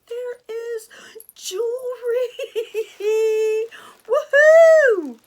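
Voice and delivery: high squeal building to a whoop; gleeful shriek with laughter; ecstatic squeal. Real, not acted. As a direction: burst into a loud, high cheer.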